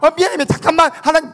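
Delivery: high, loud flustered sorry; imitated pastor scrambling to apologise; mock frantic apology. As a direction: cut in hastily with a show of repentance.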